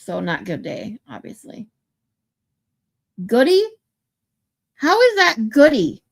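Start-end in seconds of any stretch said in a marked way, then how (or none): 3.3-3.6 Goody tried out loud, disbelieving; Goody with a louder, puzzled lift; incredulous. Real, not acted. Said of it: "Gaudet here is pronounced Goody". 4.8-6.0 baffled, louder protest; can't believe that's Goody; How is that Goody pushed up, puzzled; real disbelief. Real, not acted. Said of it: "No idea how that's Goody".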